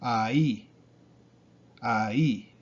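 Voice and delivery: ai as ah-EE, stress on the second vowel; ai in two beats, ah then stressed EE; two separate syllables. Shown as a demonstration. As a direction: split ai and lean on the i. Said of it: "the weak vowel has an accent".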